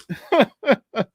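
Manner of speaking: high-pitched burst of laughter. Real, not acted. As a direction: laugh at your own remark. Laughs at own Friday remark.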